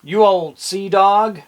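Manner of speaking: slow, loud, high pirate voice; slow, loud pirate hail; pirate growl. Shown as a demonstration. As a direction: bellow it like a salty pirate. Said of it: "another name for a pirate".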